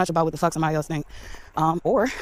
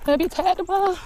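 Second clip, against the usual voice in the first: playful high voice; 13 semitones higher than usual. Pretend. Walker after a long walk, playful high voice. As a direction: pitch it way up in mock complaint. Repeats it in squeaky voice.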